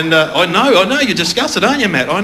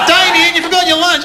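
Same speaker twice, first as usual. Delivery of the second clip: high-pitched mock mum calling out; 13 semitones higher, 4 dB louder than usual. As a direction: play the fussing mum, pitched way up. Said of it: "your mum drops you off".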